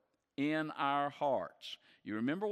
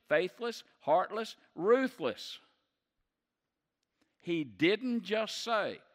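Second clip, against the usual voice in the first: full-force preaching; 4 semitones higher than usual. Acted. Pastor driving home the sins, fiery preaching. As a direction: thunder the list of sins from the pulpit.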